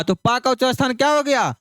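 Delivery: normal talking voice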